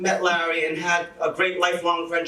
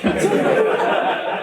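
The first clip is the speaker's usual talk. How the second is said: laugh; 4 dB louder than usual. Real, not acted. Laughs at own story.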